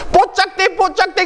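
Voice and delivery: high, chirpy Jeolla call of "뽀짝대"; 뽀짝대 in Jeolla dialect, pitched high. Retold or imitated. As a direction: call out the dialect word like a passenger giving directions. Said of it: "그대로 그 말을 쓰시오".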